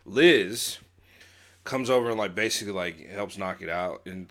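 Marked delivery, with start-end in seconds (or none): none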